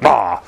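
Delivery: short loud grunt of effort. Real, not acted. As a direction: grunt as you push down hard. Effort grunt while tamping.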